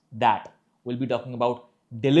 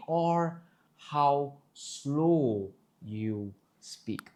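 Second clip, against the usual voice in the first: very slow, drawn-out words; slow demonstration; 5 dB quieter, much slower than usual. Shown as a demonstration. Speech coach defining pace, slowly. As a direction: stretch each word out slowly.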